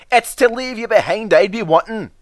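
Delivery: high, put-on Scottish engine voice; scolding the van in a broad Scottish brogue; Scottish engine Donald. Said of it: "said Donald one day".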